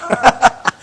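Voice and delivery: short, light, high laugh; amused laugh. Real, not acted. Hosts agreeing pools are critical, amused laugh. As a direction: share a quick chuckle.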